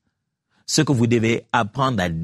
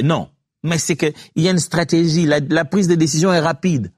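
ordinary talk, nothing marked

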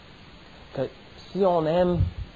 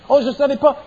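excuse d'enfant; 8 semitones higher, 5 dB louder, faster than usual. Retold or imitated.

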